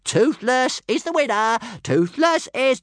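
high, loud stammering dragon brag; bragging about winning, tripping on the words; excited little dragon showing off; stuttering boast. Acted.

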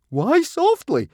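very high, loud, drawn-out squeak; panicky squeak. A narrator's reading. Swimmer questioning the warning, squeaking in fear. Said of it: "squeaked Fishlegs".

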